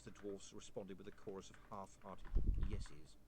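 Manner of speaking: ordinary delivery, nothing marked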